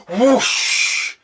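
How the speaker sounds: loud whooshing fire noise. Retold or imitated. Vlogger imitating the temple bursting into flame, loud whoosh. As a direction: blow it out like flames.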